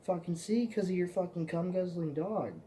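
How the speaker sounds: imitating sarcastic annoyance. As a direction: drip sarcasm at your friend. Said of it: "I was like".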